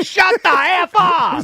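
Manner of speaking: high, slow outraged yell; fed-up shout. Retold or imitated. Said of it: "every other fan of the N B A is like".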